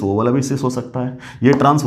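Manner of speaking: ordinary talk, nothing marked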